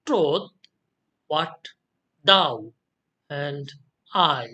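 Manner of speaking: word by word, "troth" and "thou" stressed, "what" and "and" lighter; iambic stress. Shown as a demonstration. Teacher scanning the verse, alternate stress on "troth", "thou", "I". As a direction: separate each beat, stressing "troth", "thou" and "I". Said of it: "আমি সেন্টেন্সটা বলার সময় এখানে stress করেছি".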